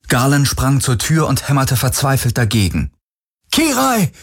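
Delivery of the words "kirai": high, ringing call of a name; calling her through the door in despair; desperate call. A narrator's reading. Galen calls desperately through the locked door, a high ringing cry. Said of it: "rief er".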